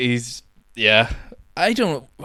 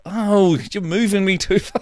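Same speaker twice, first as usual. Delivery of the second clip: whiny quote; 9 semitones higher than usual. Retold or imitated. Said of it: "he's like".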